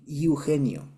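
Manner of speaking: Eugenio as i-u-HE-nio, first vowel off; gringo mispronunciation. Retold or imitated. Teacher imitating gringos' second attempt, Eugenio as iu-henio. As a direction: open Eugenio on i instead of e.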